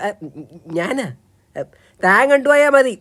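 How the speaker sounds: ordinary delivery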